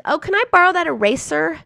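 mimicking the roommate's offhand ask; breezy roommate. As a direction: toss off the request like it's nothing. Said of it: "she's like".

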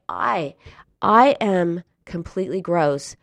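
imitating someone struggling to get the confession out; words sticking. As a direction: falter after I, pause, then force it. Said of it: "the words stuck in her throat".

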